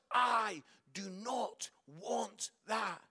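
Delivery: very slow, word by word; measured, deliberate quote. Retold or imitated. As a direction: repeat it slowly without the shouting. Words repeated for reflection.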